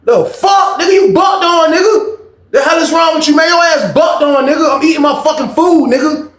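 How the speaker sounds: high, loud dare to the room; shouting a challenge to the whole cafeteria; high challenging shout. Retold or imitated. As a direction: square up to everyone. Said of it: "I said".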